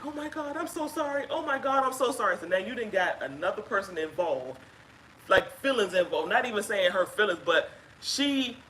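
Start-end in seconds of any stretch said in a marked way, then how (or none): 0.1-2.4 imitating an apologetic woman. Wife imitating the caller apologizing over speakerphone. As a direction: echo her frantic sorry, pitched up. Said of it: "I can hear her on the phone saying".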